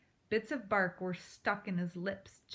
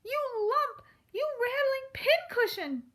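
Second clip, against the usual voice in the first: much higher, louder furious squeal; furious cry; 6 dB louder than usual. A narrator's reading. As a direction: pound him with the insult. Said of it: "she cried".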